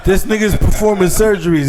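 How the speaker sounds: laughing. Real, not acted. Mocking friend's pronunciation, laughing.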